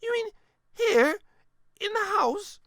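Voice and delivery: put-on high, astonished old lady; a grandmother who can't believe it. A narrator's reading. Grandmother in disbelief.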